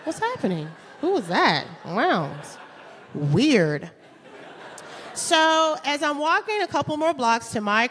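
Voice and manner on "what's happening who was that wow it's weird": gawking bystander. Retold or imitated. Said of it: "looking around like".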